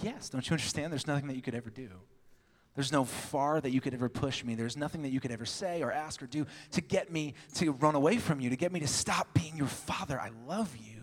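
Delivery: soft, fatherly voice of God; tender reassurance. Retold or imitated. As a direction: reassure your child with quiet love.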